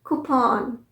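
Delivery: कूपन ending in an open "pon"; correct pronunciation. Shown as a demonstration. Teacher confirms for learners, कूपन as koo-pon. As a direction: lengthen the second vowel of कूपन. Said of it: "सही pronunciation है".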